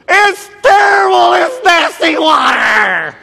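high, loud, slow revolted yell; disgusted shout. Acted. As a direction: spit out the words in disgust. People tasting bitter water.

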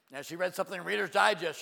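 usual speaking voice

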